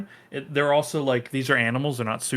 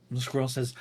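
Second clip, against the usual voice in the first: ordinary talk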